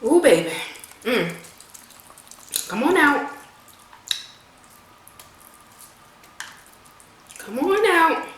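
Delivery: coaxing. Real, not acted. Coaxing meat out of shell.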